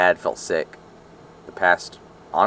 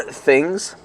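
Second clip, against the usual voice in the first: coughing; 6 semitones higher than usual. Real, not acted. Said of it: "Sorry I'm coughing a lot".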